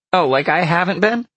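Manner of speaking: high, loud vixen retort; indignant vixen. A narrator's reading.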